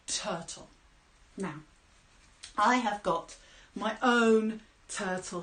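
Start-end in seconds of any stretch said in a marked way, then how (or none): 0.1-0.7 dramatic whisper. A narrator's reading. Dramatic reveal to children.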